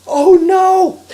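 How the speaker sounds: high, loud scream of alarm. Acted. Harry sees the stroller rolling downhill, alarmed scream. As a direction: scream it out in horror. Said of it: "Harry screamed".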